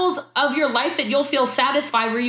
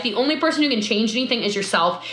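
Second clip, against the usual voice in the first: ordinary talk, nothing marked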